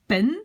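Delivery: Pen with a short, open E; short vowel. Shown as a demonstration. Teacher shows the vowel to protect, short E in Pen. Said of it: "je dois le garder court".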